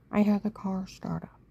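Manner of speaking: normal talking voice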